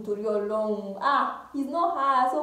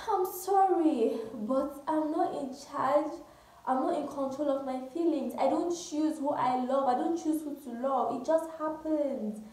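mocking apology; 3 semitones higher than usual. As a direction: mimic a helpless confession, stretching the words. Mimics friend excusing the affair.

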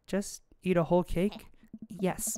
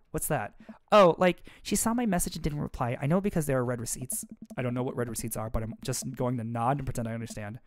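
usual speaking voice

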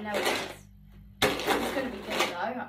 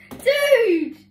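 loud, high laughing shout; laughing outburst; 13 semitones higher, 13 dB louder than usual. Real, not acted. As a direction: burst out laughing and yell. Laughs at friend's suggestion.

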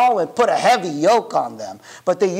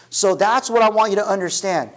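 ordinary delivery

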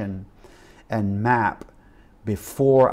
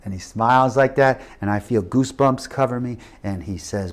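normal talking voice

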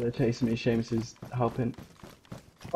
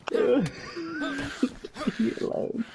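slow, high, laughing; laughing plea; 13 semitones higher, much slower than usual. Real, not acted. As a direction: plead while laughing. Chased by monster, laughing.

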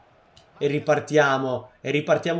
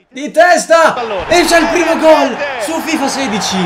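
shouted, much higher, much louder; breathless italian goal commentary; 10 semitones higher, 12 dB louder than usual. Acted. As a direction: call the goal like a stadium commentator. (telecronaca urlata del gol)

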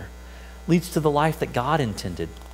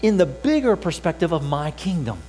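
voicing Jesus; 5 semitones higher, 3 dB quieter than usual.

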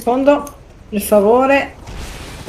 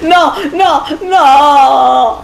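panicked shout; 5 semitones higher, 4 dB louder, much slower than usual. Real, not acted. Crash about to happen.

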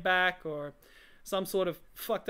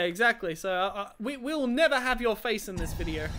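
usual speaking voice

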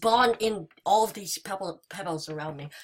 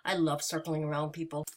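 usual speaking voice

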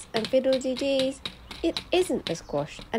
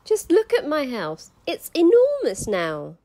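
put-on delighted old lady; 7 semitones higher, 9 dB louder than usual.

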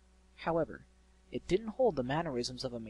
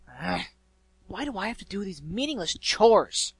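loud, high griffin grumble; exasperated exclamation; 7 semitones higher, 11 dB louder than usual. A narrator's reading. Griffin alone at her chores, exclaiming in frustration. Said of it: "Gilda exclaimed to herself".

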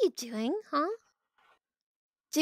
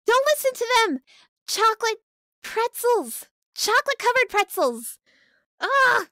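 high, loud desperate plea; hammed-up campaign yell; mock rallying cry; 8 semitones higher, 5 dB louder than usual. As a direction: shout the faction name like a battle cry. Rallying chat to her side.

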